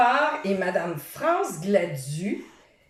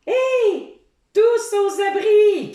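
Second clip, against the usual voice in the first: high, loud panicked cry; Sammy crie, paniqué; Sammy shouting; 12 semitones higher, 5 dB louder, slower than usual. A narrator's reading. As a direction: yell for everyone to take cover. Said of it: "crie Sammy".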